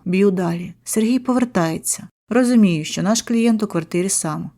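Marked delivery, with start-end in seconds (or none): none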